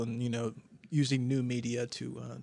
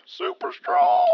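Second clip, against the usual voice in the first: very high, slow squeal on "Super strong"; squeaky stretched punchline; 6 dB louder, much slower than usual. Pretend.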